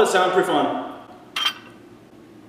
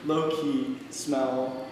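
very slow and drawn out; 4 semitones lower, 9 dB quieter, much slower than usual. Shown as a demonstration. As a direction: stretch each word slowly. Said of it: "Again, again".

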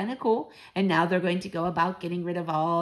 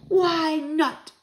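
high, loud exclamation; crying out; 10 semitones higher, 5 dB louder than usual. A narrator's reading. Grandmother answers the doubting child, crying out. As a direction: cry it out with high pitch and force. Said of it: "she cried".

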